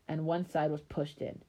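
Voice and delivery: usual speaking voice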